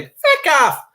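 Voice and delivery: high, punchy sitcom catchphrase; Mrs Brown. Retold or imitated. Host quoting a funny show, high mimicked catchphrase. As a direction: throw it out high and comic, as an impression. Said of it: "Missus Brown's boys".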